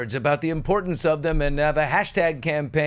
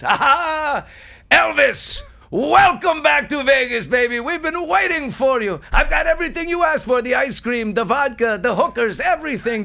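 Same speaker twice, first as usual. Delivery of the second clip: hyped-up concierge; 11 semitones higher, 5 dB louder than usual. Acted. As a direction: greet the King like a Vegas hustler. Concierge greets supposed Elvis.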